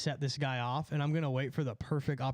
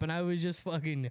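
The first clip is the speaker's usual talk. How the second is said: normal talking voice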